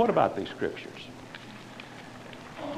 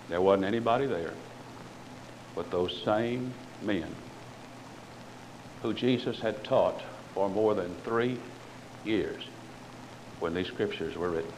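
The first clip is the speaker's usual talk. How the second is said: solemn, quiet; 7 semitones lower, 9 dB quieter than usual. Real, not acted. Solemn lowered explanation.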